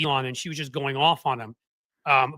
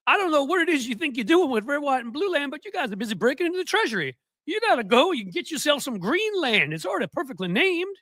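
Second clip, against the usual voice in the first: mock folksy Southern scolding, pitched up; Southern accent; 10 semitones higher than usual. Mocking a Georgia congressman in a Southern accent. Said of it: "if I may use my Southern accent".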